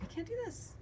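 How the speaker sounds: high, soft retold refusal; pleading refusal. Daughter retelling her refusal to dad, high soft protest. Quotes herself refusing him.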